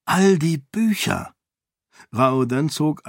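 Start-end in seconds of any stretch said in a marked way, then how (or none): 0.1-1.3 higher-pitched exclamation of delight; thrilled. A narrator's reading. Raoden gazing at all the books, thrilled. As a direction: marvel excitedly at a treasure.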